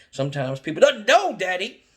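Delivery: put-on a kid preaching perseverance to dad; pleading child lecture.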